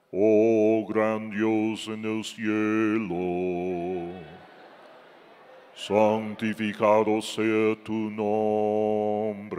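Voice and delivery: low, slow, solemn churchy drone; mock pious prayer voice. Preacher mocks how Pharisees talk to God, in a low, slow, pompous prayer voice. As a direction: parody a Pharisee intoning a prayer. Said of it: "hasta cambian su forma de hablar".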